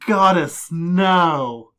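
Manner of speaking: loud horrified gasp of denial; horrified cry. Acted. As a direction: cry out in horror. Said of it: "she's clearly horrified".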